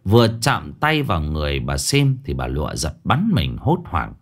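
ordinary delivery, nothing marked

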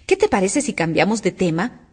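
plain reading voice

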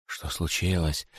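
soft, slow puzzled question; softly puzzled. A narrator's reading. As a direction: ask quietly, confused. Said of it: "спрашивали периферийные удавы". (периферийные удавы тихо спрашивают)

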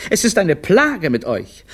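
high-pitched, admonishing mum; stern mother's high lecture; scolding mother. Acted. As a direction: lay down the law in a high voice. Mother scolds her children.

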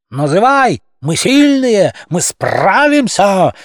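crowd clamour. A narrator's reading. Crowd shouts at Murchavis.

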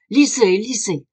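insistent urging. A narrator's reading. Duncan thrusts the newspaper forward.

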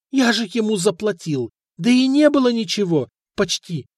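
high whimpering excuse; whining protest. A narrator's reading. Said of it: "заскулил ростовщик".

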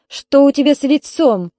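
a stern mother eyeing her daughter's face; stern question. A narrator's reading. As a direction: demand an answer sternly. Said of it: "строго спросила".

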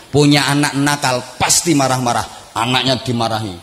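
ordinary talk

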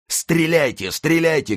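very high, urgent cries; frantic shouting. A narrator's reading. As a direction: scream the order to fire. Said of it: "кричал Мордаунд". (пронзительный крик-приказ)

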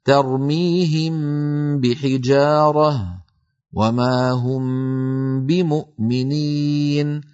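slow tajweed recitation, meem of ترميهم hummed before بحجارة; Quranic recitation with ghunna. Shown as a demonstration. Teacher recites the ikhfaa examples to students, slow and nasal. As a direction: recite slowly, hiding the meem with a nasal hum. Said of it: "أعيد الأمثلة".

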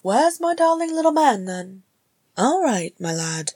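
high, cooing mother's voice; high-pitched doting lilt; mother to baby. A narrator's reading. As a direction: coo to a little son. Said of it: "Gimri said to her son".

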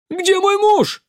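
very high, loud shriek; woman's shriek. A narrator's reading. Woman in the crowd accuses them, shrieking. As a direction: shriek it in outrage. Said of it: "взвизгнула женщина".

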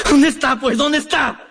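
high, pleading question; Efraín frantic for María; desperate asking. Acted. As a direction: beg to know where she is.

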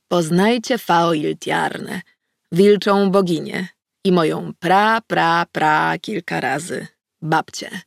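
slow, ceremonious introduction; formal tone. A narrator's reading. Narrator formally introduces the goddess, slowly. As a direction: announce her like at court. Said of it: "powiedziałam formalnym tonem".

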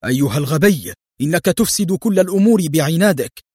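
high, exasperated outburst; infuriated superior crying out; angry exclamation. A narrator's reading. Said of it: "احتقن وجه ديفيد في شدة وهو يهتف".

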